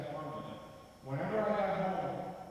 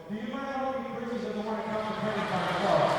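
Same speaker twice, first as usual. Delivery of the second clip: father calling out; 3 semitones higher, 6 dB louder than usual. Retold or imitated. Father calls to girlfriend.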